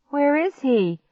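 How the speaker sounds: high, urgent question; alarmed cry. A narrator's reading. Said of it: "I cried".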